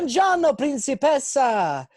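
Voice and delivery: high, drawn-out hammed-up Italian; fake Italian accent. Prankster pretending to be Italian online, hammy Italian greeting. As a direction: sing it out like a bad stage Italian. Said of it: "pretending to be Italian".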